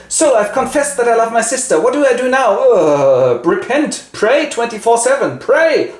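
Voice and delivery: high, loud hammed-up friar voice; mock friar. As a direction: play the fed-up friar way up high. Friar mocks confessing Giovanni.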